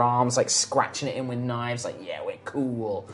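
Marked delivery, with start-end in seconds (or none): 2.0-3.1 low mutter. Acted. Skull-faced guys muttering.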